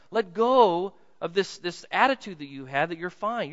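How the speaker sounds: normal talking voice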